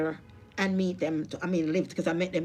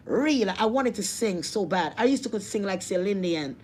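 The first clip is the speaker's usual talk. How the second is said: ordinary talk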